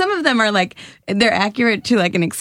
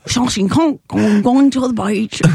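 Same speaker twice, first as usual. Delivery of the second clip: mock Russian accent; badly faked, heavy Russian accent; slower than usual. Shown as a demonstration. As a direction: put on thick Russian vowels. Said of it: "Russian people are like".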